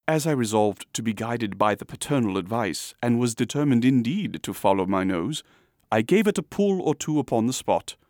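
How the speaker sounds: ordinary delivery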